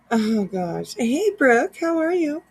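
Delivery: ordinary delivery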